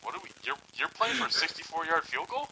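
re-enacted disbelief, climbing into the question; incredulous. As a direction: act out baffled fan, pitch rising at the end. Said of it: "I was the same way".

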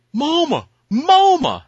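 put-on child calling mama. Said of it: "starts going".

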